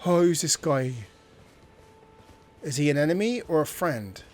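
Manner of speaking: ordinary talk, nothing marked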